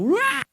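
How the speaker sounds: high, loud imitated wail of a tantrum; child's wail. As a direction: let out a shrill kid's tantrum cry. Son spinning on pharmacy floor.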